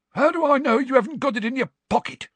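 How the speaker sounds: high, fast, loud accusation; suspicious accusing. A narrator's reading. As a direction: snap the suspicion at him. Filch accusing Harry.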